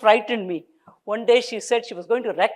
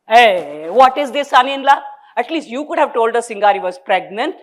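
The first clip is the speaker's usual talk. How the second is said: loud call; 3 semitones higher, 6 dB louder than usual. Acted. Mother calling to son-in-law.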